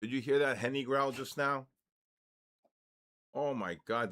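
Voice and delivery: ordinary talk, nothing marked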